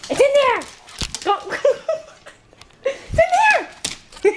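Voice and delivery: high thrilled call to the dog; excited high cry. Real, not acted.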